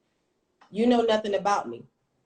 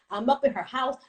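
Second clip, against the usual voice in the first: ordinary talk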